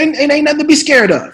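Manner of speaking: loud, high, forceful pep talk; Sam Jackson impression. Veteran actor coaching the nervous newcomer, forceful impression. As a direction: drill it into the rookie. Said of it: "He said".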